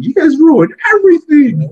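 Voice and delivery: high-pitched imitation of a whiny man; whiniest voice. Host mimicking the character running away, very whiny. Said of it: "in the whiniest voice".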